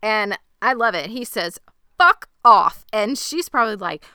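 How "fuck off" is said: loud, higher angry outburst; acted-out exasperated blast; fed up. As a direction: let him finally blow up. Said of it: "Higgins has just had it".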